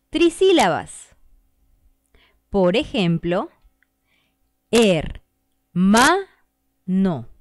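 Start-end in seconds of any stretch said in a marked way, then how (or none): 4.7-7.3 hermano split into er, ma, no with pauses; syllable split. Shown as a demonstration. Teacher splitting hermano for the class. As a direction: beat out er, ma, no as three beats. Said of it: "Son tres golpes de voz".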